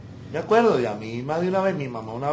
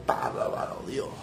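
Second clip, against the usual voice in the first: awed whisper; 6 dB quieter, slower than usual. Retold or imitated. Awed reaction to God's promise.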